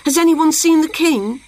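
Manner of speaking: high, anxious calling; crying out. A narrator's reading. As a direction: ask around in a fluster. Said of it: "they cried".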